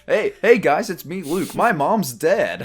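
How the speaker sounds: loud, high, bubbly vlogger greeting; mock cheerful vlogger voice; hammed-up YouTuber intro. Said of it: "It's like a YouTuber intro".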